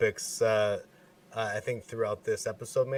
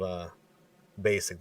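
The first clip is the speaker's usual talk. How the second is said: ordinary talk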